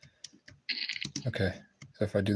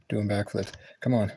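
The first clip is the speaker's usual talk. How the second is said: usual speaking voice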